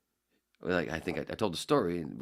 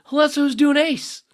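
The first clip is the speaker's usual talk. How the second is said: squeaky high cartoon voice, much louder; Ace voice; 16 dB louder than usual. Shown as a demonstration. Radio host demoing his Ace voice, very high and loud. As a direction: pop into a shrill high character voice. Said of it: "Unless I was doing a voice".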